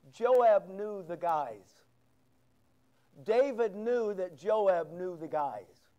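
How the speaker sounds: slow emphasis. Real, not acted.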